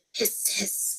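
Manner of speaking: soft unvoiced hiss; hissing flames. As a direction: hiss like flaring fire.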